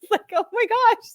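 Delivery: very high, laughing through emotion; tearful joy. Retold or imitated. Coach reliving a client's breakthrough, tearful joy. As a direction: squeal it, overcome with happiness for her. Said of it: "I was crying at this point".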